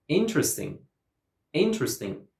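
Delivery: "interesting" as IN-tres-ting; native-style "interesting", strong IN then falling; first-syllable stress. Shown as a demonstration. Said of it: "All the native speakers say".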